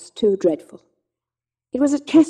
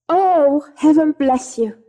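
tearful thanks; 6 semitones higher, 5 dB louder, slower than usual. Acted. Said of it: "burst into tears".

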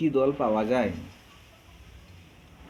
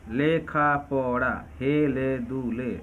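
slowed for syllables; much slower than usual. Shown as a demonstration. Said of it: "নিচের কোন শব্দটি মুক্ত দলের এক্সাম্পল?".

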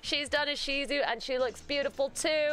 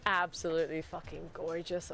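usual speaking voice

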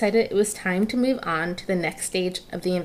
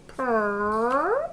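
cat purr; 2 semitones higher than usual. Acted. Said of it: "the pillow said".

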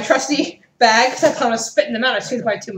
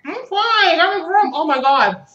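distressed; 7 semitones higher, 3 dB louder, slower than usual. Real, not acted. Hot candy burning her mouth.

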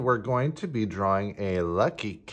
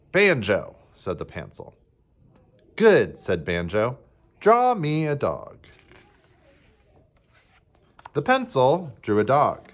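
animated picture-book reading with swooping pitch; expressive storybook reading; story narration; 2 semitones higher, 5 dB louder than usual. A narrator's reading. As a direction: read it to children, leaning on key words. Reads picture book to children.

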